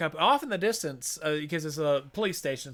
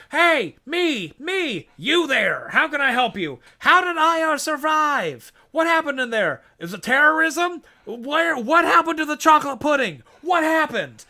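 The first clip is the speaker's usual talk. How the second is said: much higher, louder clamour of questions; shouting reporters; 10 semitones higher, 7 dB louder than usual. Retold or imitated. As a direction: fire off questions like a pushy press pack. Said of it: "people are like".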